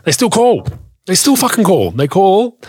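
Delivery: high-pitched, incredulous exasperation; exasperated. Real, not acted. Seller fed up with dealers ignoring his ad, exasperated.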